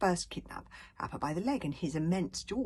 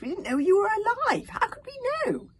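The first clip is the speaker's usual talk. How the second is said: much higher and louder squeaky protest; squeaky panic; 11 dB louder than usual. Acted. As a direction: squeak desperately at the villain. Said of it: "squeaked Fishlegs".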